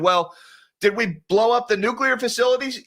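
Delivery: ordinary delivery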